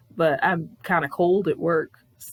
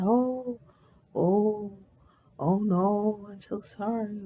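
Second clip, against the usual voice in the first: higher, halting imitation of helpless consolation; awkward sympathy; 4 semitones higher, slower than usual. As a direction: imitate someone at a loss to comfort. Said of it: "didn't know what the fuck to do with me".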